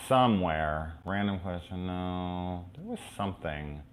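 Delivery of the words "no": usual speaking voice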